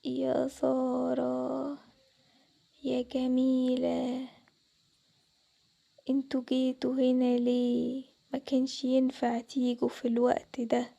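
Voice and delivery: low, slow, eerie ghostly warning. Acted. Unseen ghost calling the girls at night, low and slow. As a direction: draw every word out like a spirit in the dark. Ghost voice speaks in dark room.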